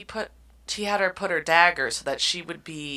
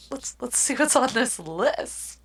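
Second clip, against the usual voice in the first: higher, slower coy line; teasing heroine; 8 semitones higher than usual. Acted. Host reading heroine teasing the vampire about his list. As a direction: draw it out playfully.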